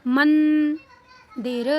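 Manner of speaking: मंदिर loud, with a long held n, दिर set apart; drawn-out anusvara. Shown as a demonstration. Teacher reading another example, drawn-out anusvara. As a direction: stretch मंदिर syllable by syllable. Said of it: "ऐसे ही यहाँ पे".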